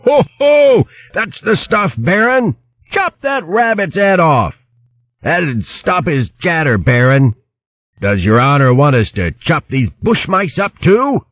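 high-pitched cheers of a hedgehog mob; rowdy shouting. A narrator's reading. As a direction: egg the chief on in high, eager cries. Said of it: "each vying to shout louder than the rest".

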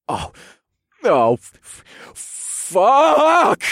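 stuttered build to a loud cry; stammer, then burst out high and loud; loud growl. A narrator's reading. Narrator overwhelmed, growling out a curse. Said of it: "I growled".

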